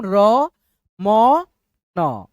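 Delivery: र, म, न said one by one with pauses; र म न spaced out, the last न without any अ sound; separated syllables. Shown as a demonstration. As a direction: break रमन into its letters for students. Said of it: "तो simple उच्चारण करेंगे".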